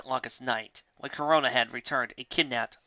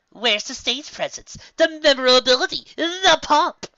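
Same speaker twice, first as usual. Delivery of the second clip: climbing much higher and louder to a grand cry; building showy outcry; 13 semitones higher, 9 dB louder than usual. Acted. Trixie lecturing Lyra on showmanship, rising to a grand cry. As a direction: build it up into a theatrical proclamation.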